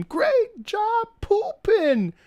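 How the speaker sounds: very high, slow praising coo; meme quote. Retold or imitated. Quoting a girl praising her pooping dog. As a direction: praise it like a doting girl to a puppy. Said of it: "You ever seen that meme?".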